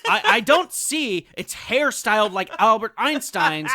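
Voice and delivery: high, loud mock customer complaint; complaining customer. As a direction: play an indignant customer returning a baby. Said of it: "I will go up to the doctor and I will say".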